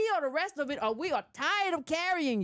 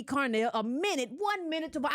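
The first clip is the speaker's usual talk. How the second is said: ordinary talk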